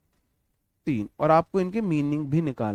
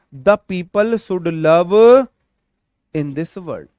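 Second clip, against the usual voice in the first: पढ़कर सुनाओ, हर शब्द अलग और धीमे; बहुत धीमे, शब्द-शब्द करके, जैसे बोर्ड से लिखवा रहे हों; slow dictation read; 8 dB louder, much slower than usual. Shown as a demonstration. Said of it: "reads the question sentence slowly". (छात्रों को सवाल लिखवाते हुए धीमा पाठ)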